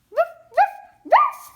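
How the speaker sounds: high, loud yappy puppy bark. Pretend. Toy puppy barking at its turn, high and loud. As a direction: bark like a tiny dog. Voicing the dog.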